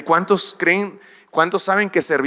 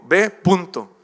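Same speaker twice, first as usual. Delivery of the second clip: louder, slow, stern order; firm command from God; 4 semitones higher, 5 dB louder, slower than usual. Acted. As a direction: lay down the order with no room to argue. God answers excuses firmly.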